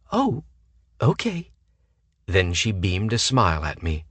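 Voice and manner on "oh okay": 1 ditzy high voice. A narrator's reading. Ditzy woman answers.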